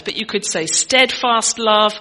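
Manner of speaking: usual speaking voice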